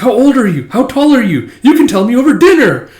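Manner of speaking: high, loud, eager questioning; eager flirting. Retold or imitated. Coworker quizzing Hemlock for a date, mock eager. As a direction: pester a crush, hammed up.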